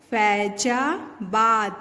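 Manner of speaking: "फैजाबाद" slowly joined; sounding out words. Shown as a demonstration. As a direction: blend "फैजाबाद" slowly from syllables. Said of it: "फ में ए की मात्रा फै".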